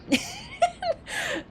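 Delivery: high burst of laughter; laughing. Real, not acted. Laughs after taunting chat.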